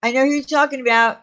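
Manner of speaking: usual speaking voice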